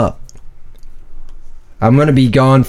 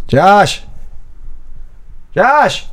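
calling out; 6 semitones higher than usual. Acted. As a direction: shout the name to find him. Looking for Josh in the lab.

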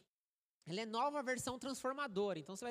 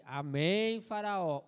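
pastor shouting; 2 semitones lower, 7 dB louder, much slower than usual. Retold or imitated. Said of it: "o pastor grita pra ele".

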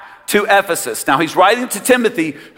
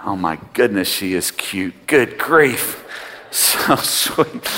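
cooing softly; 10 semitones lower, 5 dB quieter, slower than usual. Real, not acted. Pastor cooing over a little girl.

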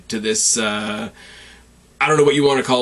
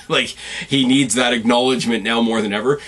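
usual speaking voice

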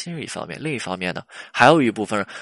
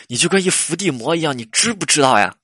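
higher, exasperated telling-off; raised, nagging reproach; scolding girlfriend; 5 semitones higher, 4 dB louder than usual. Acted. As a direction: act out a girlfriend fed up with him. Acted girlfriend scolding boyfriend.

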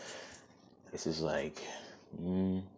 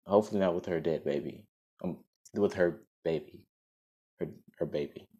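clear, fuller storytelling voice; story narration; 3 semitones higher, 5 dB louder than usual. Acted. Host reads the story to listeners, in a storybook narration. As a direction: read it out like a storybook.

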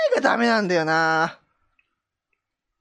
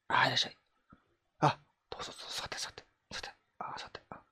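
breathy whisper inviting a client to sit; whispered fortune teller; 9 semitones lower, 13 dB quieter, much faster than usual. Pretend. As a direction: hush it, like a shady back-room psychic. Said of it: "小声で話せばいける。".

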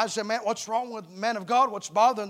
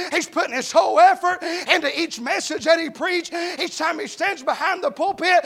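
preaching that swells to louder, higher shouts; building sermon; 4 semitones higher, 6 dB louder than usual. Acted. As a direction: build the sermon up to full fire. Preacher builds to full force.

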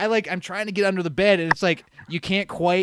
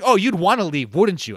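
louder mock reproach; half-yelled accusing jab; retold accusatory yelling; 7 dB louder than usual. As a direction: imitate someone lashing out in accusation. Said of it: "am I getting yelled at".

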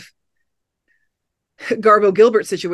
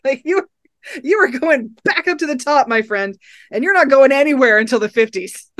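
giddy cheering; 7 semitones higher than usual. Pretend.

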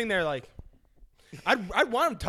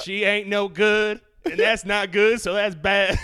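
mock loud verdict; 8 dB louder, much slower than usual. Real, not acted. Joking loud over laughter.

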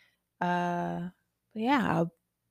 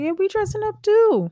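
mock cocky reply; 6 dB louder than usual. Wife mocks husband's disbelief.

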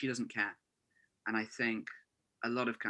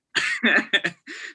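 loud laugh; 9 semitones higher, 13 dB louder than usual. Real, not acted. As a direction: burst of high loud laughter. Laughing at own joke.

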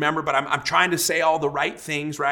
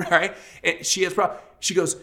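ordinary delivery

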